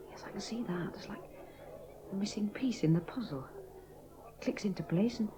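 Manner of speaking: soft breathy whisper of realisation; hushed whisper, as if a secret clicks into place. Acted. Amy whispering to Jim as it all makes sense. Tender whispered moment of love.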